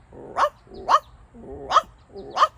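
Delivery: high, loud playful woofs; dog barking. Pretend. Reading to children, barks like the book's dog. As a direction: bark like a happy puppy for the kids.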